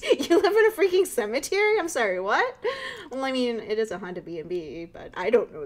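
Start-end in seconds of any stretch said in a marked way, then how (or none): 0.0-2.6 much higher and louder, incredulous; shocked disbelief. Acted. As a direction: blurt out in shock. Shocked reply to cemetery.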